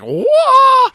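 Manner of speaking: high, loud martial arts cry; mock karate yell. [name] striking a karate pose on the amp, yelling. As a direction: shout it like a karate kid taking a stance.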